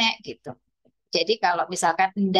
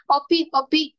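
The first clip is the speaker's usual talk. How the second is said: put-on toddler asking for car; 7 semitones higher than usual. Shown as a demonstration. Teacher acting a toddler asking for a toy car, high and eager. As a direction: ask for the toy like a small child would. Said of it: "misalkan mobil".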